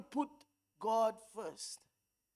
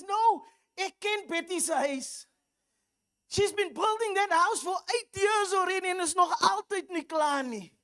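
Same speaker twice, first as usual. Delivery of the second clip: higher, Afrikaans-flavoured comic auntie; gossipy auntie rattling on about Betty; Auntie Merle; 5 semitones higher, 4 dB louder than usual. Retold or imitated. Pastor to congregation, comic Afrikaans auntie explaining Betty's unfinished house. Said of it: "he's got this character called Auntie Merle".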